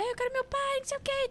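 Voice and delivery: high-pitched mock child wail; criança gritando. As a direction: imitate a child's tantrum without full volume. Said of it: "ele começou a gritar". (birra de criança, imitada em tom agudo)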